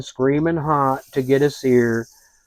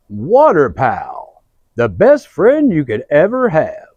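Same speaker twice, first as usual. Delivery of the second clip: announcer voice; 5 dB louder than usual. Acted. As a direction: sell the slogan Water Pal. Ad slogan.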